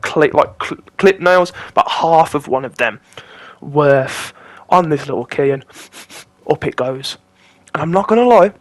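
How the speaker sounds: ordinary talk, nothing marked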